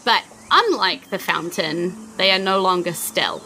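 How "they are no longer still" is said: ordinary delivery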